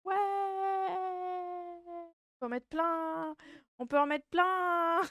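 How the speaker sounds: high, delighted squeal; cute anime streamer. Real, not acted. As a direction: gush happily at the screen. Cheering emote wall on screen.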